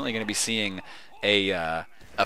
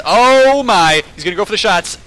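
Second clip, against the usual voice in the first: excited; 8 semitones higher, 10 dB louder than usual. Real, not acted. Exciting play in commentary.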